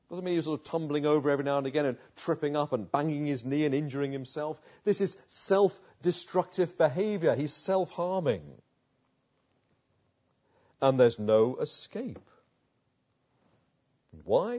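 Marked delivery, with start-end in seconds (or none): none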